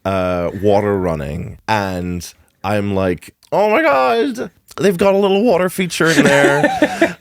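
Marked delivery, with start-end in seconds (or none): 3.5-7.2 high-pitched, gushing retold delight; acted-out wide-eyed excitement, pitched well up; excited realisation. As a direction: squeal it like a thrilling discovery. Acts out delighted, mistaken realisation.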